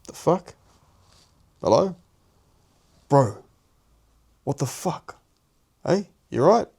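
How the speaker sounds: high startled calls at the door. Retold or imitated. Roommate startled by knock at door, high alarmed calls. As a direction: sound confused and alarmed.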